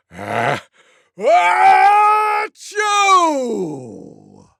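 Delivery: huge sneeze, head thrown back; loudest sneeze. Acted. Tiger gives the loudest sneeze. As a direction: throw everything into it. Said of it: "loudest sneeze you could ever imagine".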